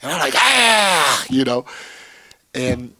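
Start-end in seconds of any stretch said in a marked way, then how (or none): 0.4-1.2 loud, high drawn-out "aah"; mock exasperated yell. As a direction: act out a coach yelling in frustration. Acts himself yelling at player.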